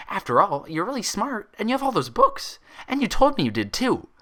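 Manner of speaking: high, eager and loud pony chatter; excited high pony voice; eager Pinkie. A narrator's reading. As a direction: gush it eagerly. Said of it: "she said with an eager bob".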